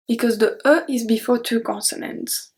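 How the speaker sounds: ordinary talk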